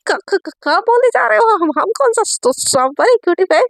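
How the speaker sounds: acted stutter on the opening sounds; flustered stammer. Satya flustered by Ayesha's pet name, stammering. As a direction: trip over the words, rattled by the question.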